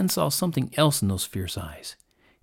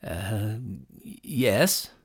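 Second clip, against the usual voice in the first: hesitant, halting reply; hesitate, then just get the word out; barely answering; 2 semitones lower, 4 dB quieter than usual. A narrator's reading. Frightened hunter answers the lion, barely able. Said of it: "Sen could barely answer".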